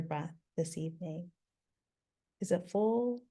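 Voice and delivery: soft-edged, unhurried meditation voice with long pauses; calm, spacious guiding, phrase by phrase; guided meditation. Real, not acted. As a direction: gently guide them into stillness, leaving space between phrases.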